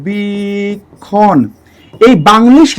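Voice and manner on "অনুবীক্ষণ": very slow, as if writing it out; drawn-out spelling. Shown as a demonstration. Teacher mimes spelling the word in Banglish, drawn out slowly. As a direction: stretch অনুবীক্ষণ out sound by sound. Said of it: "ইংরেজি লিখতে চান".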